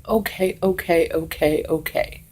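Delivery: soft, soothing, dismissive "okay, okay"; patronizing okays. Retold or imitated. Mimicking people who brush her off, softly patronizing. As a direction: humor her like she's crazy, quietly. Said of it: "Don't patronize me".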